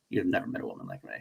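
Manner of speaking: low, soft, cool Wonder Woman line; hushed low movie-line delivery; quoting Wonder Woman. Retold or imitated. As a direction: drop low and quiet, coolly confident.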